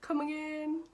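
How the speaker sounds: very high retold disbelief; incredulous. Storyteller replays hearing they'd been together, incredulous. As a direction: pitch it right up, as if you can't believe it. Retold shock after long pause.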